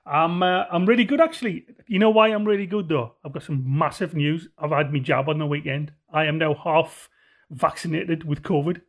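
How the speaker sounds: ordinary talk, nothing marked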